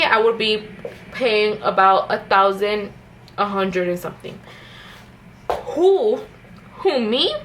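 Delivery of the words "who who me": higher, incredulous "who, me?"; mock disbelief. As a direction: act out the shock of the bill. Reacting to the huge payment.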